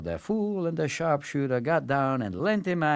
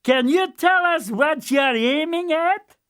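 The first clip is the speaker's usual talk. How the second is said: high, loud and cheery; a merry simpleton calling down; the fool; 5 dB louder than usual. Acted. Fool questioning the marksman, high and loud. Fool's voice.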